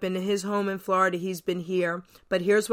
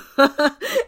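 loud high laugh; bright loud laugh at the punchline; burst of laughter; 6 semitones higher, 8 dB louder than usual. Real, not acted. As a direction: let a delighted laugh out.

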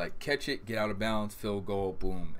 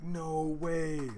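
stunned disbelief; 7 semitones higher than usual. Retold or imitated. Reacting to the touchdown.

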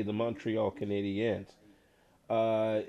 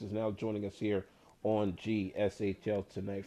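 normal talking voice

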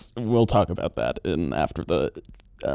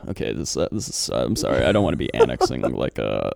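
ordinary talk

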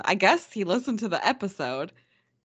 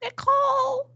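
high cackly witch voice; 3 dB louder than usual. Pretend. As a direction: screech it like a witch. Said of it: "That'll be my witch voice".

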